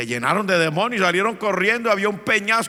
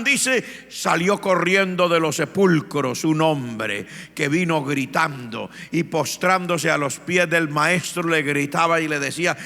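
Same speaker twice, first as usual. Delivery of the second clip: ordinary talk, nothing marked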